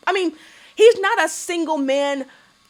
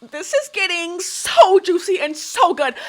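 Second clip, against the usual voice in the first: gossipy excitement; 5 semitones higher, 3 dB louder, slower than usual. Retold or imitated. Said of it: "And I was like".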